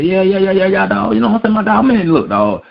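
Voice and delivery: high, excited bluffing voice; high mocking impression of a bluffing player; mock Jamarcus Russell. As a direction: play the lying player eagerly faking it.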